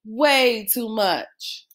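plain reading voice

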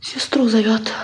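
a hushed whisper to herself; whispered worried aside. Acted. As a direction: breathe it so no one hears. Aside about the dying husband.

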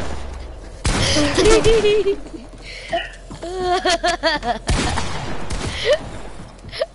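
drawn-out wheezing laugh; long laughing fit. Real, not acted.

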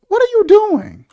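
high, loud outcry of disbelief; exasperated scolding. Pretend. Critic scolding the film's grown man, exasperated.

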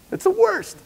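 sudden squeaky jump way up in pitch; voice cracks high. Real, not acted. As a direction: voice shoots up into a squeak mid-rant. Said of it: "I don't know why my voice went that high".

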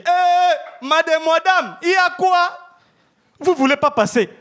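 high-pitched, put-on African accent. Man scolding a woman at the crosswalk, high African accent. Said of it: "je vais m'échapper avec mon accent africain".

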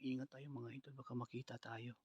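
hushed, hiding voice; whispering while hiding. Real, not acted. Hiding from man with shovel.

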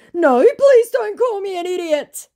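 high, whiny plea; whiny pleading scammer. Retold or imitated. Triggered scammer begging, pitched high. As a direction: beg like a hurt scammer.

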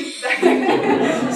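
short breathy laugh through emotion; tearful laugh. Real, not acted. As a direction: laugh it off while moved. Emotional moment, aunt in audience.

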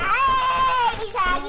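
high, loud drawn-out "hey"; loud indignant holler; outraged call-out. Real, not acted. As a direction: yell an accusation at a cheater. Outraged at cheater in game.

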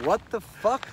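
high, loud indignant outburst; acted outrage. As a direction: play the furious assistant protesting. Retold angry outburst from assistant.

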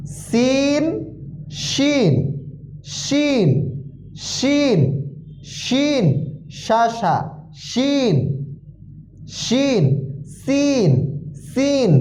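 teacher modeling letter sounds; careful letter drill. Shown as a demonstration. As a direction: contrast the hissing সিন with the hushing শিন. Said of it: "উচ্চারণের দিকে সব থেকে বেশি খেয়াল করব".

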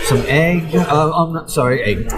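usual speaking voice

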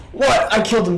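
sharp, high, loud What; surprised shout. Real, not acted. Gamer shouting at a boss who beats him, real surprise. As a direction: blurt it out in disbelief. Losing a fight he won before.

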